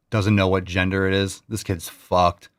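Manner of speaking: ordinary talk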